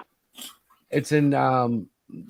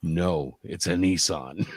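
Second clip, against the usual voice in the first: lower, slow, deadpan troll reply; 8 semitones lower, 4 dB quieter, slower than usual. Acted. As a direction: drop the punchline flat like a bored troll. Troll character answers in story.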